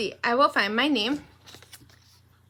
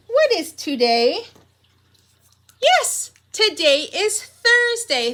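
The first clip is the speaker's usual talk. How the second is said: much higher, loud and slow, thrilled; excited; 7 semitones higher, 5 dB louder than usual. Shown as a demonstration. Teacher announcing the day to pre-K, excitedly. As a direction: cheer out the day. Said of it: "with an excited voice".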